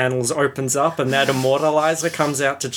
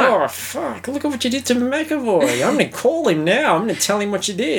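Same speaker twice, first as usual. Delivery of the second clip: squeaky indignant ocker rant, a touch faster at the end; high mock Aussie rant; 10 semitones higher than usual. Friend mocking an angry Aussie fan, high-pitched rant. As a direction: voice pitched way up, outraged bloke. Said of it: "it's Australian as well to just be like".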